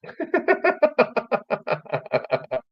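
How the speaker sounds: long high-pitched belly laugh; high laugh tailing away; real laugh. Real, not acted. Laughs at friend's retold joke.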